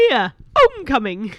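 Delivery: loud put-on Paisley voice; [name]'s Paisley accent. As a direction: imitate a Paisley local, pushed loud. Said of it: "that was definitely [name]".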